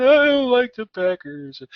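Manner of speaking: put-on high, slow pouty little-girl voice; mock sulky child; imitating upset daughter. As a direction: whine it like a sulking kid. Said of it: "and she's like".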